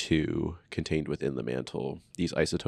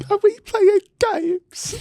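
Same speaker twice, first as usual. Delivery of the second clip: high-pitched imitation; 6 dB louder, slower than usual. Imitating drunk friend.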